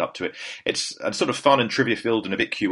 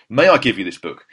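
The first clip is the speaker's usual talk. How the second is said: the same request asked again, louder; louder repeat; 2 semitones higher, 7 dB louder than usual. Retold or imitated. To Colin Firth, offering the one copy, louder. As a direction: press the question a second time, louder.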